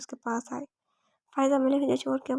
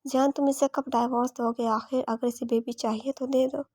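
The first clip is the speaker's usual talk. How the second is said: ordinary delivery, nothing marked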